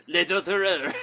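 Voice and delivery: hammed-up French accent shout. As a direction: yell it with a put-on French accent. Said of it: "do it in a French accent".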